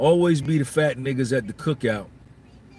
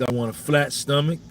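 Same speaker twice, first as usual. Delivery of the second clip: usual speaking voice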